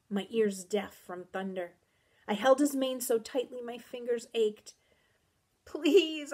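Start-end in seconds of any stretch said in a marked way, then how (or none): 5.6-6.3 high, tearful plea; desperate and tearful; sobbing. A narrator's reading. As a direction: beg through sobs. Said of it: "I sobbed".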